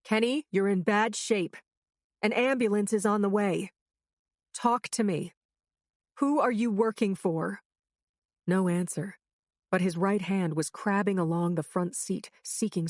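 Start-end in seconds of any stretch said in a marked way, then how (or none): 0.0-7.6 higher, urgent questioning; loud urgent. A narrator's reading. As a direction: press the wounded man for answers. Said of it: "I said loudly".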